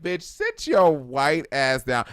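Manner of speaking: mock scolding retort. Talking back to Kim on screen, mock scolding. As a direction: lean on each word, as if putting her in her place. Acted retort to Kim's put-on talk.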